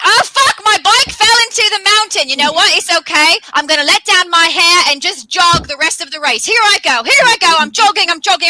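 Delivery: high, loud panicked racer; frantic. Acted. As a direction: play the crash and jog on breathless. Acted race mishap in story.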